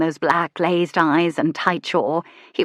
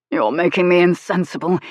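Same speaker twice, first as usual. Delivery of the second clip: rasping louder strained whisper; rasped; 4 dB louder than usual. Acted. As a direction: rasp it out tensely. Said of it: "he rasped".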